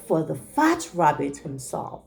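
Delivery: plain reading voice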